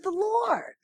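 soft but very high, falling firmly; high singsong certainty, dropping at the end; confirming echo. Retold or imitated. Pastor's sure echo, second time.

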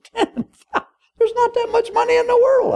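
hammed-up shock; mock astonishment. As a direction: gasp it out like an outraged king. King astonished at debt.

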